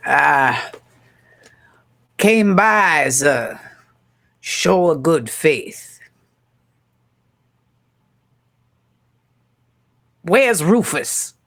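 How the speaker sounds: slow, lightly voiced showman; ringleader voice. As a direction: draw it out like a sly ringleader. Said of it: "He goes".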